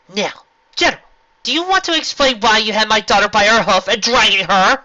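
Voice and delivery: loud, high irritation; irritated. A narrator's reading. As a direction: demand it irritably. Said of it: "Her voice filled with irritation".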